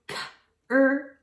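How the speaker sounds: "k-er-u-s-t" as five separate speech sounds; "k-er-u-s-t" sound by sound, gaps between; separated sounds. Shown as a demonstration. Teacher segmenting crust for kids, "k-er-u-s-t" chopped up. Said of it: "remember to separate the beginning sounds".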